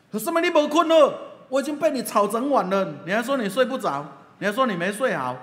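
high, loud, exasperated scolding; a pastor's loud, high, indignant retort about a sleepless night; indignant complaint. Retold or imitated. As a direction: hurl the accusation back at him, worked up. Pastor scolds him next morning.

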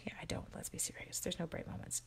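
whispered deadpan aside. Real, not acted. Joking to viewers about no bright ideas, whispered deadpan. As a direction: drop to a whisper, wry.

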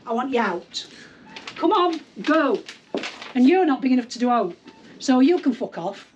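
ordinary talk

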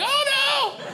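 high squealed oh no; a sudden shrill cry of mock despair; mock commercial panic. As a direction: ham up a cheesy ad actor's dismay. Couple in commercial hit by sun.

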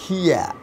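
"হিয়া" pronounced hee-a, no final r sound; hear pronunciation. Shown as a demonstration. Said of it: "উচ্চারণটা হবে কি?".

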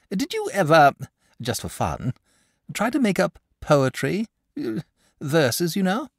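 a timid creature working up courage; bashful dragon. Acted. Dragon shyly asks the boy about poetry. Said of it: "he said bashfully".

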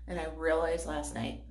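ordinary talk

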